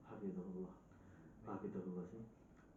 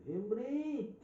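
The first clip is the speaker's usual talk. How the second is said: loud, high-pitched telling-off; raised voice, acted rebuke; scolding shout; 10 semitones higher, 6 dB louder, slower than usual. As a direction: imitate an exasperated teacher scolding a student, name drawn out. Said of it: "dibentak itu Pak Syekh Himri".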